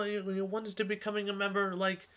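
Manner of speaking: usual speaking voice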